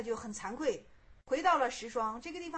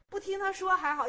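plain reading voice

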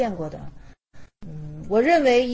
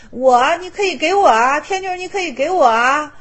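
loud, eager call of give them to me; eager loud claim; 5 semitones higher, 8 dB louder than usual. Retold or imitated. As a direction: call out boldly to take the gift. Vimalakirti claims the maidens.

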